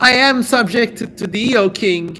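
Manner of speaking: high, pleading cry to the king; hammed-up wail of surrender; mock cry aloud. A narrator's reading. Demon woman crying out to King Solomon, mock high wail. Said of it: "But she cried aloud".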